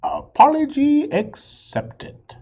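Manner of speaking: high, loud, slow hammed-up moose voice; moose's reply. The moose accepts the beaver's apology, high, loud and slow. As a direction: boom it out like a goofy moose.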